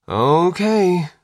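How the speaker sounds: high, drawn-out uneasy okay; nervous. A narrator's reading. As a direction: stretch it out high, unsure of yourself. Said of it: "said nervously".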